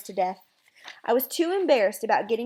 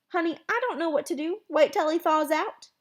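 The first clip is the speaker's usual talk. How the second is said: higher, at-a-loss reply; helpless exasperation; 7 semitones higher than usual. Acted. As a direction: answer as if baffled, pitched up. Dad at a loss, teasing.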